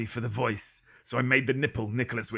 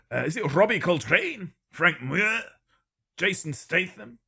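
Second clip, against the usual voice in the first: ordinary talk, nothing marked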